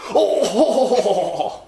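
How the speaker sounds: high, loud mock-British "oh"; hammed-up British exclamation, drawn out; mock British accent. Imitating the bar guy's British impression, hammed-up accent. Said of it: "do an imitation of a British accent".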